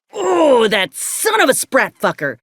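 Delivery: a sharp high "Oh" that breaks into a curse; angry outburst. Acted. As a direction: spit the insult out the moment it dawns on you. Sudden realisation of betrayal.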